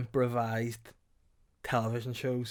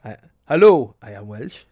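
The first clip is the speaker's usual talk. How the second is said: bad Welsh accent, loud hello; hammed-up Welsh guard; 2 semitones lower, 11 dB louder than usual. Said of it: "the worst Welsh accent ever".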